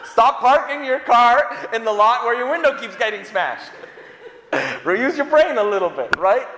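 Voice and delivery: mock telling-off through laughter; laughing scold. Preacher mock-scolding the careless car owner, laughing. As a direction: tease them, laughing as you scold.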